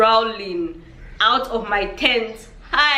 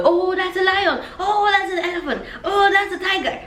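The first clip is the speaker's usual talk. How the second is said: high, bright excited calls of each animal; excited spotting; 9 semitones higher than usual. Retold or imitated. Tourist pointing out animals to her sisters, excited. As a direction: squeal each animal out in delight.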